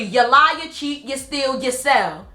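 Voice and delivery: normal talking voice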